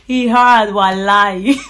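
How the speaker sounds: loud, laughing burst; laughing exclamation. Real, not acted. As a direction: crack up and shout it out. Laughing repeat of sister's phrase.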